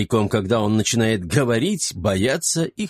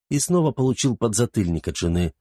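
usual speaking voice

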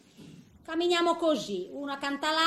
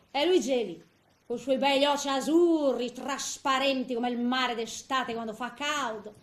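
ordinary delivery

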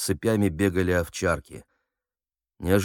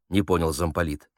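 ordinary delivery, nothing marked

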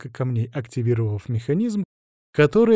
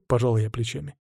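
usual speaking voice

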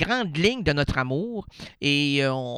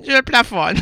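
clipping loud; 8 semitones higher, 8 dB louder than usual. Shown as a demonstration. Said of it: "le son me dit que je plafonne".

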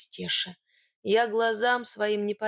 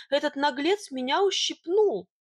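higher, louder indignant tattling; aggrieved, pitched-up complaint; complaining; 5 semitones higher, 3 dB louder than usual. A narrator's reading. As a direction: whine to your protector about the offender. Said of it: "нажаловалась я".

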